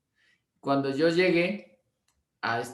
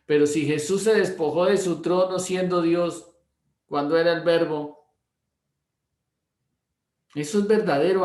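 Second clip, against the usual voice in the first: louder, raised preaching voice on Jesus and true love; fervent preaching; 3 semitones higher, 4 dB louder than usual. Acted. Pastor preaching to his church, loud and fervent. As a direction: drive the point home to the congregation.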